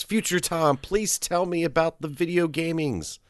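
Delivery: put-on high, eager little boy voice; child voice. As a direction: play an excited young kid asking. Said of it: "child Tom comes running up to you and says".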